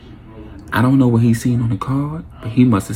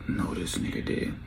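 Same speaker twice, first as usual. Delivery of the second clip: hushed, breathless whisper; 12 dB quieter than usual. Retold or imitated. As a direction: breathe it out in horror. Watching shooter chase cousin.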